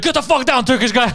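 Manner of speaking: a hint of an angry cop; mock cop bark. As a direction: snap at him like a rude cop. Cop voiced in retold story.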